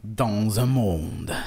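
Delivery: very slow, low trailer narrator; movie trailer voice. Acted. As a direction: drag it out like a film trailer. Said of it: "comme le mec qui refait les voix de bande-annonce".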